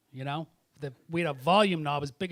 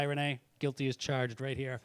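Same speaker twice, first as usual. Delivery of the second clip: normal talking voice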